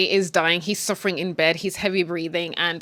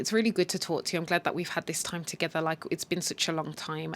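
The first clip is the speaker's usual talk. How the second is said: soft; 3 semitones lower, 7 dB quieter than usual. Retold or imitated. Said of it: "he's like".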